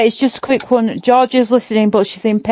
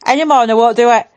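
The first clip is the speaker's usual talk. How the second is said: ordinary talk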